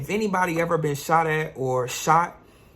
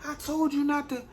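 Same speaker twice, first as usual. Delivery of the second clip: much higher, whiny accusing voice; angry little brother; 12 semitones higher than usual. Retold or imitated. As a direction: squeal it like a scared kid blaming you. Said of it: "My brother's whooping my ass".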